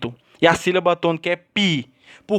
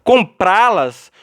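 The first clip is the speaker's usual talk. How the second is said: "Comprá-las" drawn out, weight on PRÁ; stress on PRÁ; much slower than usual. Shown as a demonstration. Teacher showing students the tonic syllable, slow stress on PRÁ in "Comprá-las". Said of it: "a sílaba tônica está na última".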